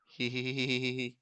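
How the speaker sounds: sneaky little snicker; sly nasal snickering whisper. Pretend. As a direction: giggle like getting away with something. Sly giggle, nobody here.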